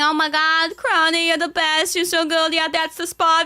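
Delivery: gushing massage praise. Retold or imitated. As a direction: replay the blissful praise like it's happening again. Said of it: "I was saying".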